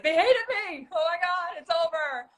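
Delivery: high-pitched panicked wail, acted out; nervous wreck. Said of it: "I was a nervous wreck".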